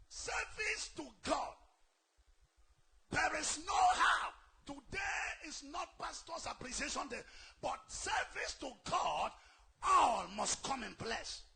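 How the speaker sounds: slow, loud and high, every word pounded; crying out from the pulpit; fierce preaching shout. Acted. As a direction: roar it at the congregation. Climax of sermon exhortation.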